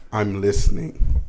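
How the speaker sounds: slow, lightly mocking mimic of her; a hint of her tone thrown back; imitating partner. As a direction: drawl it back at her slowly. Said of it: "When you say things like".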